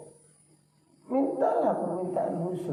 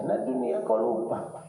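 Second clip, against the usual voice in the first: usual speaking voice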